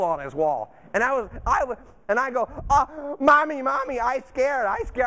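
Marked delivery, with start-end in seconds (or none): 2.7-5.1 higher, childlike mock whimper; mock scared. Dad spooked by moose on wall, mock scared child voice. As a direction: play a grown-up reduced to a frightened child. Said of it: "I was scared to death".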